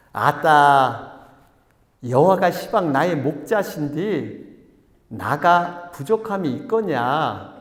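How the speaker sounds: slow, drawn-out Jeolla drawl; hammed-up Jeolla scripture reading, stretching the words; Jeolla dialect. Pastor reading the psalm's Jeolla version, hammed-up dialect. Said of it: "전라도 분이 어떻게 내 이렇게 한대요".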